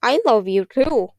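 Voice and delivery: a lightly gurgled fishy voice, slow; wet fishy voice. Acted. Girl throws a fishy voice from the wash basin. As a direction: burble it like a fish in the basin. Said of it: "wet, fishy voice".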